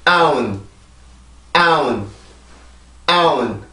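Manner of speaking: 아운 with stress on 아, n closing; rhythm drill with n. Shown as a demonstration. Teacher adding the n sound in a drill. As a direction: chant 아운 as one stressed beat ending in n. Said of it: "N 발음이 들어갑니다".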